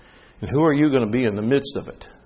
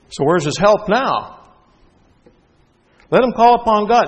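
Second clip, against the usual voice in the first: mocking crowd; 10 semitones higher, 6 dB louder than usual. Crowd at the cross jeering at Jesus, loud mockery. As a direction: taunt him with contempt.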